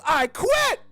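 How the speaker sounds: quitting shout, crypto rich and loud; triumphant shout. Retold or imitated. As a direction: shout it in the boss's face. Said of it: "turned around, says".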